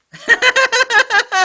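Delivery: loud, very high burst of laughter; a big, high laughing fit. Real, not acted. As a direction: howl with laughter. Laughs hard at himself.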